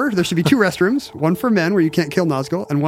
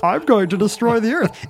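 cartoon villain voice; 6 semitones higher than usual. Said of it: "do an impression of cartoon characters".

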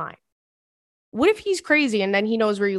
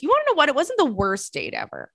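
excited; 6 semitones higher than usual. Real, not acted. Turning to defend the date, a high excited burst. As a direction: pop up with sudden energy. Sudden turn in reaction.

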